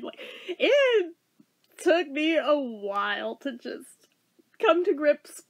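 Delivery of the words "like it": ordinary talk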